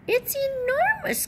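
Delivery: squeaky high hen voice, amazed; clucking smaller chicken. A narrator's reading. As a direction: cluck it like a tiny excited hen. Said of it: "clucked the smaller chicken".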